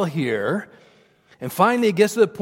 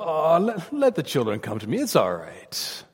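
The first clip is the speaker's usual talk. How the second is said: gentle Jesus; 2 semitones lower, 4 dB quieter than usual. Retold or imitated. Jesus welcoming the children as we imagine, gentle and soft. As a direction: reassure them like a kindly shepherd. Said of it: "Sounds very gentle".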